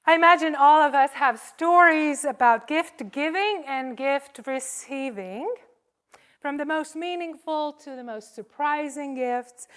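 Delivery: ordinary delivery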